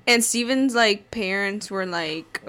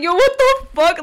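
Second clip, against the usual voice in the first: high, loud startled outburst; shocked yo what the fuck; 11 semitones higher, 8 dB louder than usual. Retold or imitated. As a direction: blurt it out like the car just failed on you. Retold panic at failing car.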